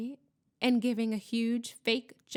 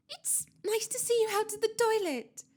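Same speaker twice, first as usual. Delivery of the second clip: high, forced-cheerful voice; falsely bright; 12 semitones higher than usual. A narrator's reading. Student flatters the touchy ghost, falsely bright. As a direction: gush with fake brightness. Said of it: "in a falsely bright voice".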